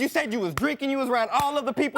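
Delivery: high accusing shout; angry shouting. Real, not acted. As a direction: throw it at her. Shouting match with girlfriend.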